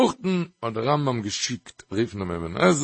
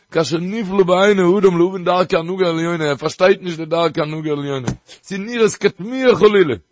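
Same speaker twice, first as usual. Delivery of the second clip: loud, raised sermon chant; fervent preaching; 5 semitones higher, 7 dB louder than usual. Acted. Preacher pressing the point on his listeners, loud and raised. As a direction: drive the argument home with full force. Heated Talmudic argument, preaching.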